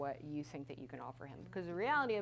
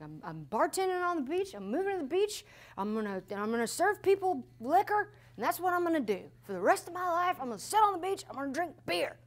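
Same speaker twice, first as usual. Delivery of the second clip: higher and louder, fast, defiant; mock defiant declaration; 12 semitones higher, 9 dB louder than usual. Quoting her burned-out self quitting ministry, hammed-up defiance. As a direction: announce it like a fed-up quitter. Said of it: "Literally, I said that".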